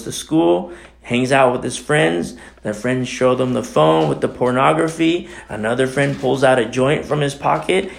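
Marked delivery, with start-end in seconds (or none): none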